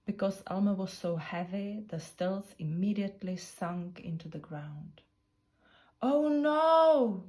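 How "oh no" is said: higher, louder dismayed groan. Acted. Elephants watch the stilts sink, loud groaning dismay. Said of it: "groaned the elephants".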